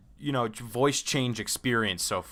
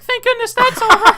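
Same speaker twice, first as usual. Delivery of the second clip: very high and louder with a laugh; squeaky relieved voice; 10 dB louder than usual. Retold or imitated. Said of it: "you're like".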